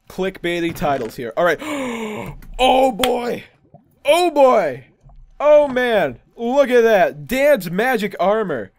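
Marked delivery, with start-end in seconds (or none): 1.6-2.5 big sharp intake of breath; amazed gasp. Real, not acted. Finds treasure in chest. 2.6-8.7 loud, high-pitched delight; thrilled, raised voice; excited discovery. Real, not acted. Delighted at finding magic armor.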